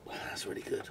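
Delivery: breathy whisper to himself; whispered aside. Real, not acted. Tasting Chardonnay on camera, a whispered private verdict. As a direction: murmur it under your breath, savouring.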